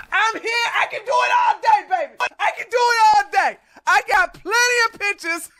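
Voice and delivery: gleeful taunt. Real, not acted. Taunting a commenter, high and gleeful. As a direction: crow it out triumphantly.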